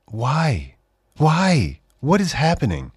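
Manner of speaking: ordinary talk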